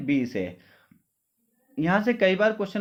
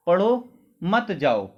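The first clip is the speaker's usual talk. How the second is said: पढ़ो rising, short stop, then मत जाओ; pause after पढ़ो; slower than usual. Shown as a demonstration. Teacher telling students to stop briefly, pause after पढ़ो. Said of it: "फिर थोड़ी देर रुक के बोलो".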